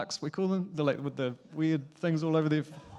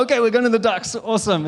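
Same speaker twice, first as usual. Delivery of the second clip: higher, louder, cheering agreement; excited dad; 7 semitones higher, 6 dB louder, faster than usual. Retold or imitated. Dad cheerfully telling his toddler they're going, excited. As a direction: sell the outing like a big treat.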